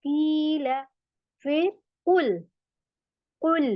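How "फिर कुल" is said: ordinary talk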